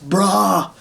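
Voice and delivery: high-pitched, updated surfer dude; bro voice. Shown as a demonstration. Puppeteer demonstrating his bro alien, high mock-dude voice. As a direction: stretch out the bro-speak up high. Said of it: "have a voice much like that".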